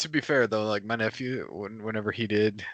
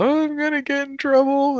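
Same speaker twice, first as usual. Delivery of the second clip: put-on crying kid; 6 dB louder than usual. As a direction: imitate a weepy seven-year-old, much higher. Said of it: "he's, like, crying".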